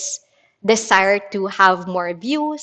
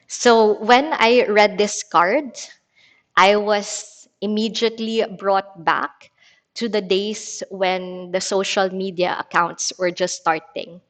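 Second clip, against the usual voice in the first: normal talking voice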